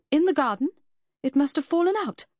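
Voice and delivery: high, loud, flustered protest; blustering. A narrator's reading. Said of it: "Lucy blustered".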